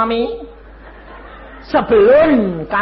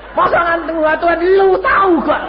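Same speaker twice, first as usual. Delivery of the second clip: high, loud mock demand; brash convert impression of prayer; 11 semitones higher, 5 dB louder than usual. Preacher mimics the convert's rude prayer, loud and brash. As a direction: throw it at God with no manners.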